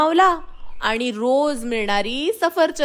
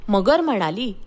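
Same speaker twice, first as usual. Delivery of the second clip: ordinary delivery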